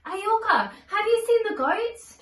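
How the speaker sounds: mother calling her daughter home, loud and high. Acted. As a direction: call out loud and high across the yard.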